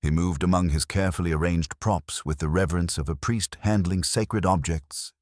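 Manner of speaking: ordinary delivery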